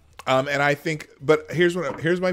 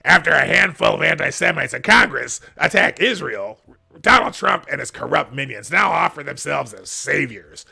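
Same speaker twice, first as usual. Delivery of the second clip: loud, raised, overheated political tirade; Richard Painter; 3 semitones higher, 9 dB louder than usual. Retold or imitated. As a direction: declaim it at full volume, mock-outraged. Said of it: "my best Richard Painter voice".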